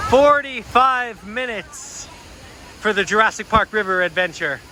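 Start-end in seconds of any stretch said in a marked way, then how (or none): 0.1-2.1 Forty-five minutes, slow and higher after a pause; dramatic reveal. Shown as a demonstration. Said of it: "dramatic pause".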